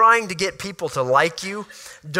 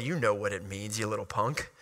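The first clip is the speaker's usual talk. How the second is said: low, much softer grumble; muttered retort; 7 semitones lower, 10 dB quieter than usual. Retold or imitated. Annoyed brothers answering Joseph's coy question, low muttered retort. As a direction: grumble it under the breath, annoyed.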